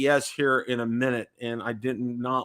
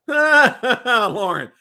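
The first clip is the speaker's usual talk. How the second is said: laughing; 10 semitones higher, 8 dB louder than usual. Real, not acted. Laughs while greeting a viewer.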